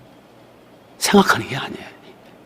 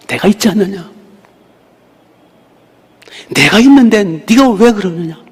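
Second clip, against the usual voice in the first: fond rebuke; 5 semitones higher, 3 dB louder than usual. Retold or imitated. Lord gently rebukes.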